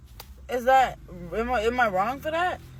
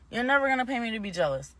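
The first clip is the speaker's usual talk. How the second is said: ordinary talk, nothing marked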